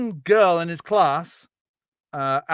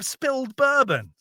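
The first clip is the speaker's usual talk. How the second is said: usual speaking voice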